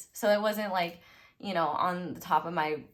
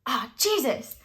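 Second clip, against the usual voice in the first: sudden pitched-up exclamation, a touch louder; startled yell; 6 semitones higher, 5 dB louder than usual. Retold or imitated. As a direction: blurt it out as the chair tips. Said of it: "And I yell and I go".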